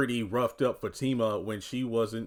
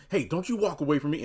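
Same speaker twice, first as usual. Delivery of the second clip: imitated confrontation; 6 semitones higher, 4 dB louder, faster than usual. Voices Fatima grabbing onto Gary.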